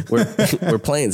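lazy Plains farmer twang, half-joking; farmer accent. Pretend. Asked about Oklahoma, he slips into a light farmer accent. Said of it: "That's my farmer accent".

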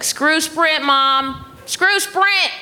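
high, rousing rallying cry; re-enacted fired-up outburst. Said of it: "got her all fired up".

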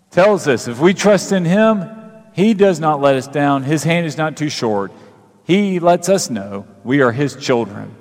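normal talking voice

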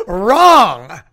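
booming mock correction; mock announcer verdict. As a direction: blare it like a wrong-answer alarm. Slide rejects the statement.

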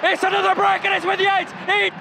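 high, loud burst of excitement; excited. Real, not acted. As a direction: call it like a goal chance. Live match excitement.

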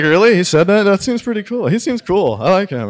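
a touch of giddy surprise; mock delight. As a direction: gush it like a new fan. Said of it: "I was like".

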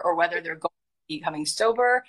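usual speaking voice

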